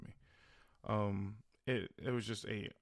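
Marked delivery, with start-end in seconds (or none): none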